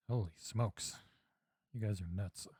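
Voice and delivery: ordinary talk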